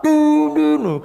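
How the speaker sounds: loud high honking noise; car horn imitation. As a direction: blast it like a horn. (tiruan klakson keras dan tinggi)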